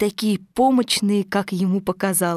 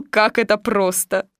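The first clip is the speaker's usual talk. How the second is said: plain reading voice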